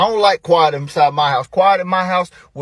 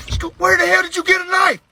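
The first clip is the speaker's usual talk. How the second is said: high, strained parental outcry; acted-out horrified dad; shocked yell. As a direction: yell in alarm at the child. Shocked at child's pocket knife.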